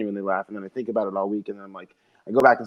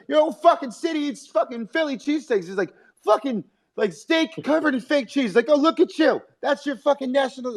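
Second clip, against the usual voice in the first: mock Burr rant. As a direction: sneer at the cheesesteaks. Imitating Burr roasting Philly.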